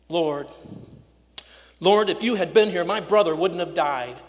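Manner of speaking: loud, raw grief hurled at Jesus; bursting accusation. Acted. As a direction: burst through the door and blame him aloud. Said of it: "in the same tone that she busts through".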